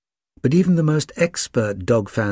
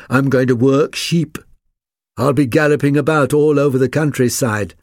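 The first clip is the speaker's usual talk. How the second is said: loud, higher-pitched puppy boast; boastful sheepdog puppy; 6 semitones higher, 6 dB louder than usual. A narrator's reading. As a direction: declare it proudly at full voice.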